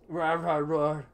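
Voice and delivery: hammed-up dinosaur roar; mock dinosaur roar. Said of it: "playing a dinosaur".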